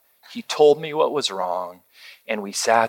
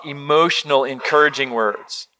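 ordinary delivery

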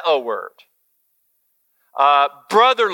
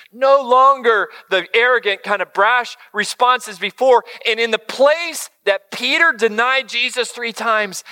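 ordinary delivery

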